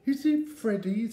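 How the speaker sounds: put-on high, loud childish amazement; big high-pitched wonder; astonished little Eddie. A narrator's reading. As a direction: exclaim like an amazed small boy. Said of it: "said Eddie".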